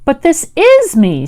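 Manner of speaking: the bear startled at being doubted; very surprised. A narrator's reading. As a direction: say it as if you can't believe the question. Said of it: "very much surprised".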